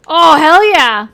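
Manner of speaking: loud, slow, drawn-out cheer; excited shout. Real, not acted. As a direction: belt out the hell yeah. Exclaims excitedly.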